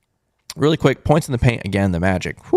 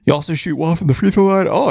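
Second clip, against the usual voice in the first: words stretched by a yawn; 4 semitones higher than usual. Real, not acted. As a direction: talk through a yawn. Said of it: "The rare yawn".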